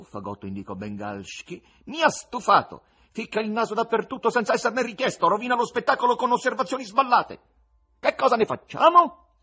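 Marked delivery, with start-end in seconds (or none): 1.9-9.4 high, loud, exasperated bleat; fed-up goatish tenor. Acted. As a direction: complain shrilly to the whole house.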